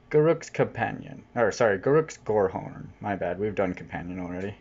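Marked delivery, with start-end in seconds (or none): none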